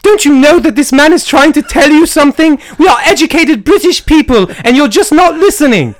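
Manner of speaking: hammed-up British girl; horrible British girl voice. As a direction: play a bad falsetto English lady. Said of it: "horrible girl British voice".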